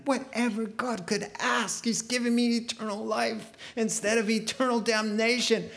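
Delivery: hammed-up self-pity; mock whining. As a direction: play a believer who thinks it's too hard. Mocking a complaining believer.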